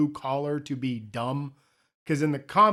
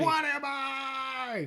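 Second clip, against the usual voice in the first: high, long-drawn wail; mock anguish; 10 semitones higher, 4 dB quieter, much slower than usual. Moviegoer neither mad nor happy, wailing in mock anguish. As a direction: stretch it out in a hammed-up high cry.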